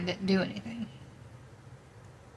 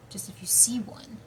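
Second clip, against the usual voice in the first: whispering; 2 semitones higher than usual. Real, not acted. Eerie lore aside.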